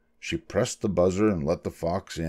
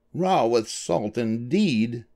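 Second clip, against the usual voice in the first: slow, slightly high indignant grumble; mildly scornful muttered remark; muttering; 4 semitones higher, much slower than usual. A narrator's reading. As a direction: grumble it slowly to a spouse. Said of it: "muttered Doctor DeSoto".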